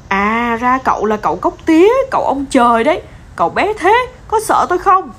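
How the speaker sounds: high, lively kitten voice; young cat's high, eager questioning; curious little kitten. A narrator's reading. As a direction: pitch it up and lift into the question.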